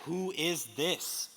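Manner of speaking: "Who is this?" stretched slow, rising at the end; slow weighty question. Real, not acted. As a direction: ask it slowly, leave it open. Question repeated.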